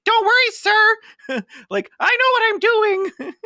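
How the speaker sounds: way up high, a kid playing lawyer; squeaky kid lawyer. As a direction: chirp it like a cocky teenage attorney. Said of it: "It's like".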